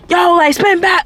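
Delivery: high-pitched mock holler; mock shout, pitched well up; imitated distant yell. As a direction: imitate someone calling out from outside. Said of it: "hearing it through the fucking window".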